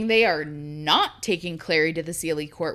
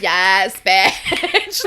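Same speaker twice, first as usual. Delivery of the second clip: gleeful yes; 8 dB louder than usual. Retold or imitated. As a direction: let a delighted yes burst out laughing. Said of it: "I was like".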